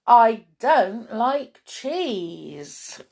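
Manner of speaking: very slow protest, each word stressed; drawn-out story-character distaste; disgusted complaint. Acted. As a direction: spell out the dislike word by word. Moo disgusted by cheese.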